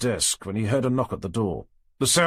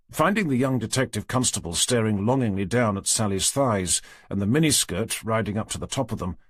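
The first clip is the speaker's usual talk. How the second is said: plain reading voice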